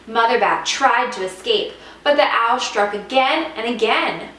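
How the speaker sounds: plain reading voice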